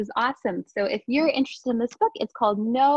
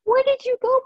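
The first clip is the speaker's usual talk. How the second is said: much higher, louder call; 13 semitones higher, 5 dB louder than usual. Acted. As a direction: call out to a friend who went missing. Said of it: "the animals cried".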